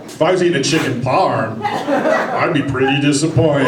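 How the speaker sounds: slow, mock-thoughtful musing; imagined inner voice. Imagined diner musing over his meal, slow and mock-pleased. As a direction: savor each word like a food critic. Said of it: "if your thoughts are like".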